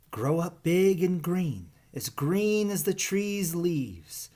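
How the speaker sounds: a mother snake calling her hatchlings off; mother python calling. Acted. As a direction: sing it out to the little ones as they go. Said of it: "she called to her little yellow babies".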